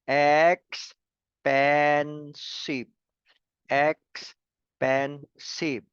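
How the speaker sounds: very slow EX, PEN, SIVE with gaps between; syllable by syllable. Shown as a demonstration. Teacher showing three syllables to students, very slowly one by one. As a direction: stretch EXPENSIVE into three separate beats. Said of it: "When we say three syllables".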